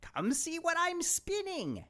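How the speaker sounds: high luring witch voice; old crone. Acted. Old woman tempting Rose to the wheel, high witch voice. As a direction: coax her toward the wheel, witchy.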